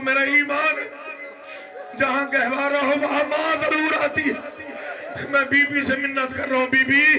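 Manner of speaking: preacher pleading aloud to Bibi at the majlis; pleading lament. Acted. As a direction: beg the lady to come and receive the mourning.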